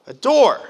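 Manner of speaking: "a door" punched out high and loud; stress on door. Real, not acted. Pastor revealing the sermon image, door stressed loud and high. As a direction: land hard on "door".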